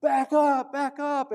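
loud, high coaches' shout from the sideline; coaches yelling. Retold or imitated. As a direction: yell it like a coach warning his player. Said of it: "they're, like, saying".

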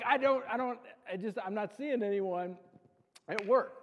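husband fumbling a mock-defensive answer to his wife; flustered reply. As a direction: sputter out a defensive excuse.

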